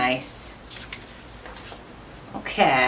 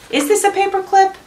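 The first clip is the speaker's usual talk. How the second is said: much higher, quick disbelieving question; surprised; 12 semitones higher, 5 dB louder, faster than usual. Real, not acted. Vlogger puzzled by a giant clip, surprised and high. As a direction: sound amazed at the size.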